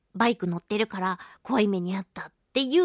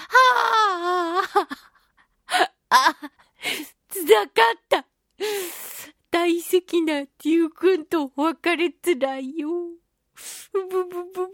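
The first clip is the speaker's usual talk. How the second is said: hammed-up fake crying, higher and louder; mock sobbing; 6 semitones higher, 3 dB louder than usual. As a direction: wail like a kid losing a toy, half in jest. Mock grief over bike farewell. (愛車との別れをおおげさに嘆く泣き真似)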